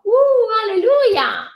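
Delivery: sudden high, loud cry of praise; joyful alleluia. Real, not acted. Preacher to congregation after scripture, a high loud joyful alleluia.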